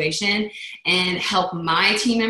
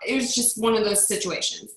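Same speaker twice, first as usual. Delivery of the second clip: ordinary delivery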